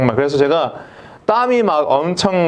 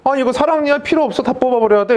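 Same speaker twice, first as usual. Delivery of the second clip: quick, higher, offhand; careless army dentist; 7 semitones higher, faster than usual. Retold or imitated. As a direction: brush it off like a quack. Army dentist casually orders extraction.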